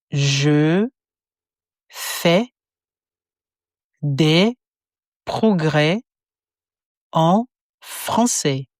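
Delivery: each word spaced apart for repetition; slow word by word. Shown as a demonstration. Teacher splitting the phrase into slow separate words. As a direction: break it into slow single words.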